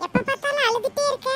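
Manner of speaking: put-on playful kid voice; young boy poking fun; teasing little brother. Younger brother teases his sister.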